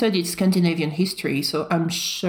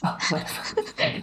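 laughing; 5 dB quieter than usual. Real, not acted. Laughing at host's joke.

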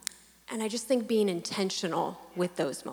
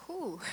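breathy whew; 4 semitones higher, 9 dB quieter than usual. Real, not acted. Hard question about resting with kids.

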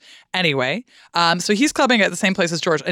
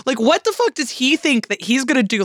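rising, higher, half-joking outrage; playful indignation, pitched up; mock outrage; 7 semitones higher than usual. Real, not acted. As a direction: get worked up over a cover song. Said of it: "this makes me so angry".

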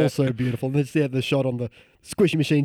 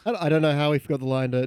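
ordinary talk, nothing marked

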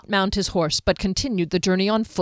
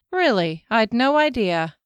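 dry mock surprise, a touch louder; sarcastic; 4 semitones higher, 3 dB louder than usual. A narrator's reading. Kier mocking Derry's lecture on the weather, sarcastic. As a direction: needle him with fake amazement. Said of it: "she said sarcastically".

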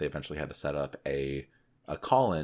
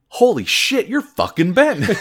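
much higher and louder, amazed yell; astonished shout; 13 dB louder than usual. Retold or imitated. Firefighter convinced after the recital, astonished shout. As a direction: blurt it out at the top of your lungs.

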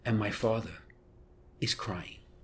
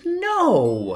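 loud, high, drawn-out mock protest; mother's emphatic denial; 13 semitones higher, 8 dB louder than usual. Mother correcting her son about Dad, exasperated. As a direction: imitate an exasperated mom crying out. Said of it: "She said".

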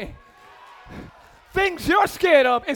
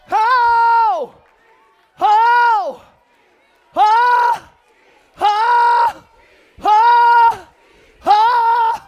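loud, high ho calls; louder, higher chanted hos; rhythmic preaching ho shouts; 7 semitones higher, 9 dB louder, much slower than usual. Real, not acted. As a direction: punch each ho to the crowd.

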